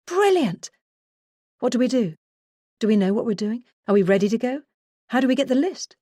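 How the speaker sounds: bursting out high and eager; excited. Retold or imitated. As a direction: rattle off eager questions. Narrator's excited reaction to news.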